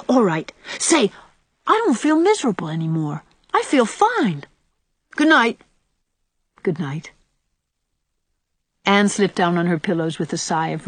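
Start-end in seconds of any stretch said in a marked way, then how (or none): 0.1-4.4 put-on high child voice, relieved; Davy, a cheered-up little boy. A narrator's reading. 5.1-5.6 put-on high, loud child's good night; cheery little boy. A narrator's reading. 6.6-7.1 weary adult reply. A narrator's reading. Anne's sleepy answer to boy.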